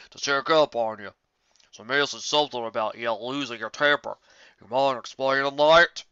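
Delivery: put-on slow, loud, heavy older-brother voice; Big Mac's voice. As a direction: press your sister firmly but slowly. Big Mac questioning his sister.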